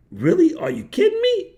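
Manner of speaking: put-on a light Boston accent, incredulous; Massachusetts accent in disbelief, lightly imitated. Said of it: "But with the Massachusetts accent".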